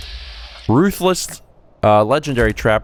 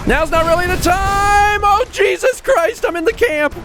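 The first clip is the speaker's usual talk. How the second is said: panicked yelling. Real, not acted. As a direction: yell in panic as the monster closes in. Monster chasing him into camp.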